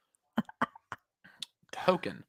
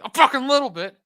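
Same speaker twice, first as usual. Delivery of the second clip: high, loud, exaggerated admission; mock jealous outburst; 11 semitones higher, 8 dB louder than usual. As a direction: blurt the jealousy out over the top.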